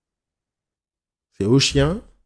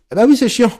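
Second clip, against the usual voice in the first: much higher and louder; exasperated mock retort; 12 semitones higher, 9 dB louder than usual. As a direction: throw the complaint back, loud and high. Acted retort to complaining owners.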